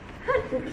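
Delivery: high ticklish squeal; squealing laugh. Real, not acted.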